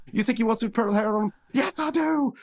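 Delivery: high, eager voiced banter; cartoon banter. Rigby and Mordecai egging each other on, high banter.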